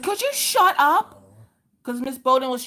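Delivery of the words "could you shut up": put-on high, slow, fed-up little girl. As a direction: drag it out like a shy kid finally snapping. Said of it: "She's like".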